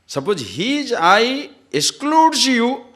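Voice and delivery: "I" and "excludes" pushed high and loud, "excludes" stretched slowly; stress on excludes. Acted. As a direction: lean hard on "excludes you" as the key contrast. Stressing "I" in contrast.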